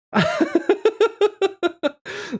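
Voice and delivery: long, high giggle; helpless string of high giggles; laugh. Real, not acted. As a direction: burst into high-pitched laughter. Amused by chat.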